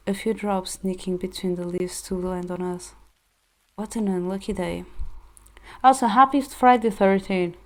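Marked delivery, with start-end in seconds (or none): 5.8-7.5 loud, bright holiday greeting; cheery aside. Real, not acted. Streamer wishing viewers happy Friday thirteen, loud cheer. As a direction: pop out of the story to greet chat.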